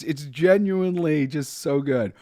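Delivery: ordinary talk, nothing marked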